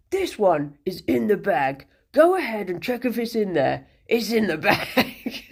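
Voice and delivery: big Cockney hedgehog voice; cocky Cockney, dragging out the last phrase; Bristleback. Acted. Hedgehog predicting he'll win the game, broad Cockney accent. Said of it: "Cockney hedgehog".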